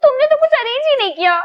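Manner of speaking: pouting complaint to the groom; whiny complaint. Acted. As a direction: rush it out in a pouting accusation.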